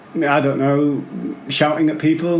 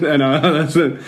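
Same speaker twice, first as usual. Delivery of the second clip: normal talking voice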